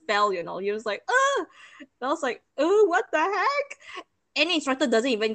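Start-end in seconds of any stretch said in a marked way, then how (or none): 1.1-1.5 sudden high, loud "ugh"; high yelp-like grunt; startled grunt. Retold or imitated. Brother's startled near-fall acted. 2.6-4.0 high, loud, drawn-out shock; stretched, incredulous outburst; shocked disbelief. Retold or imitated. Acting out shock at near crash.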